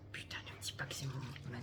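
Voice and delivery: fast anxious whisper to herself; worried whispered aside. Real, not acted. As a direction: breathe it out fast, no voice. Dreading a spilled product, to herself.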